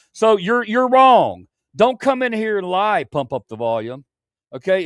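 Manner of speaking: loud, high accusing shout; angry. Real, not acted. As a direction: yell it at the liar. Angry confrontation with chatter.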